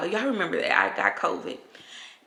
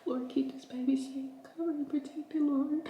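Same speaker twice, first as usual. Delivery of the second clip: higher, soft earnest prayer; whispered prayer; 8 semitones higher, 6 dB quieter than usual. Real, not acted. Praying softly for the unborn baby.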